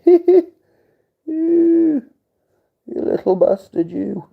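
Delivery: very high, loud squeaky voice teasing the stump; mock the little stump in a silly high voice; squeaky taunt. Talking to his missing finger, squeaky mock scolding.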